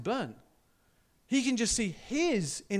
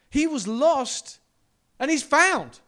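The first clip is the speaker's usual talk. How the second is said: louder, slow and high; proclaimed; 6 semitones higher, 9 dB louder, slower than usual. Acted. Preacher reading the father's words, proclaiming loudly. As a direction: ring it out like a proclamation.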